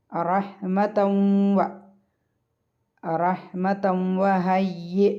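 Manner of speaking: very slow റഹ്മതൗ വ, the join held as a nasal hum; merging with ghunna. Shown as a demonstration. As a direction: stretch the nasal "w" between റഹ്മതൗ and വ. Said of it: "മണിച്ചുകൊണ്ട് ലയിപ്പിക്കുക".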